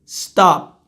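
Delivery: "stop" ending with closed lips; unreleased p. Shown as a demonstration. Teacher demonstrating, "stop" with no air after p. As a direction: make the p of "stop" but hold the air. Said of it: "faço a boca do P".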